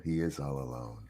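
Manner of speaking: low, soft put-on sympathy; mock pity. Teasing friend about pitying replies to his tweet, mock pity. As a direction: imitate onlookers feeling sorry for him, dropping low and quiet. Voicing others' pity for him.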